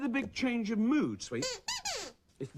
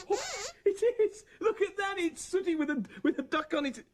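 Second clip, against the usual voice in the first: high-pitched burst of surprise; astonished; 11 semitones higher, faster than usual. Acted. Man discovering Sooty disguised with a duck, astonished. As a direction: exclaim as the truth dawns, pitched high.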